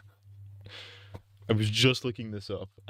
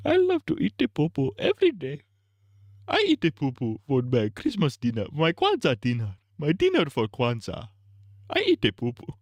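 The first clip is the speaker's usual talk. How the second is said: mock Nigerian accent; 5 semitones higher than usual. Streamer's mock Nigerian accent questioning Kwanzaa, very high.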